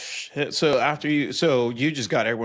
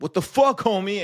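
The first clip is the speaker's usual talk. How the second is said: much higher, louder confrontational holler; mock outraged shout; 9 semitones higher, 6 dB louder than usual. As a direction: yell it like a stranger squaring up. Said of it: "Other people are like".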